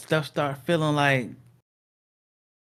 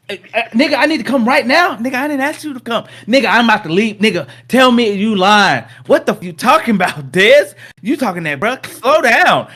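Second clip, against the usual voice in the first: higher and louder, laughing through; bickering cousins, both yelling; hammed-up phone argument; 10 semitones higher, 9 dB louder than usual. Two cousins arguing on the phone, loud and high.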